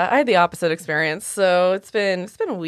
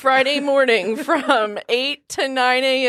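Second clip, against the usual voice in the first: slow, deliberate, higher mock offer; pointed slow sarcasm; sarcastic availability; 6 semitones higher, slower than usual. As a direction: spell out the tiny window slowly.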